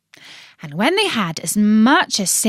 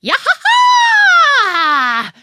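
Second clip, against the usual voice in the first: very high, slow, loud cackle; witch's screeching cackle; 8 dB louder, much slower than usual. Acted. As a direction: cackle in triumph like a wicked witch. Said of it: "she screeched".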